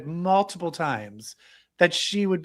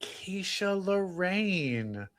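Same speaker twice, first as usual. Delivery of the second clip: very slow, softer repeat; savouring echo; 3 semitones higher, 6 dB quieter, much slower than usual. Retold or imitated. Repeating co-host's drag name, slow savouring echo. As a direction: draw the name out slowly.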